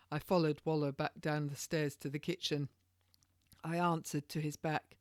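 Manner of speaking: usual speaking voice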